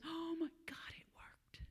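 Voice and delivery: high, whispered tiny voice from far away; a little voice calling back from the distance, amazed; breathless tiny whisper of wonder. Retold or imitated. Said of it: "you hear his little voice".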